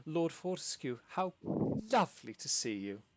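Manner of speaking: lightly voiced flustered impresario; flustered hostess. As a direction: gush nervously while covering for the troupe. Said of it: "Quacosa composes herself and goes".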